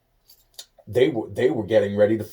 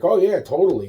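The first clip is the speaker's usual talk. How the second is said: mock eager agreement; 8 semitones higher, 6 dB louder than usual. Mimics his own eager agreement.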